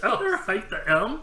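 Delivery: a hint of a caught-out liar; mock confession. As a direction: blurt out the truth, half laughing. Admitting the lie, laughing.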